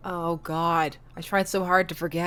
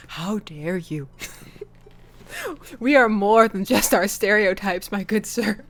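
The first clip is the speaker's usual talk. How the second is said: mock offense.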